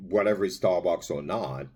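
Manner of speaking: normal talking voice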